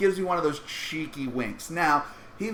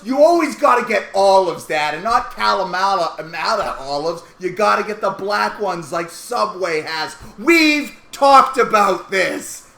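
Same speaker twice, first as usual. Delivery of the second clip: scolding daughter; 7 dB louder than usual. Retold or imitated. Daughter lectures her dad.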